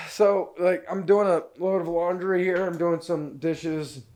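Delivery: ordinary talk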